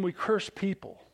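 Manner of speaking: ordinary delivery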